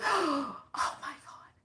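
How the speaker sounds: breathless whispered gasp. Retold or imitated. Client whispering in shock to healer. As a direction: whisper it in awe.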